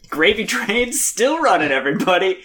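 high-pitched hammed-up celebration; mock gleeful cheer. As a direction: announce it to all like a gloating profiteer. Gleeful healthcare companies cheering on.

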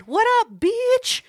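high, exuberant greeting to a friend; phone greeting. Retold or imitated. Caller greets a friend on the phone, exuberant greeting. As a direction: sing out a high-pitched hello. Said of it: "that's how I call people".

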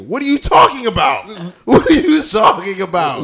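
loud, incredulous comeback; mock outrage. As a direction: act out indignant disbelief, raising the voice. Acted comeback to boastful girlfriend.

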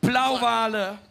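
loud amazed exclamation; excited storytelling. A narrator's reading. Interpreter echoing the climax of the catch, excited storytelling. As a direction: belt it out as the climax of a list.

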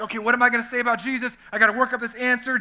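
fast, high, loud nervous fretting; hammed-up panic about what to say; mock fearful. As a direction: rattle it off like a panicky witness. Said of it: "instead of being all fearful".

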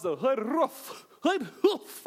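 sudden squeaky-cartoon lion bit, then jumps way up; Uncle Joey impression. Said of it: "my Uncle Joey impression from Full House".

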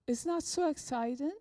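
normal talking voice

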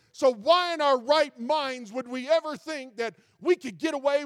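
loud, high, rising preacher's cry; full-force preaching. Acted. Pastor defies Bill Gates before his flock, thundering preaching. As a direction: build to a thundering call from the pulpit.

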